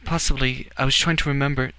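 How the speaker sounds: high-pitched, mildly sardonic reply. A narrator's reading. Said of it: "the sardonic tone was back".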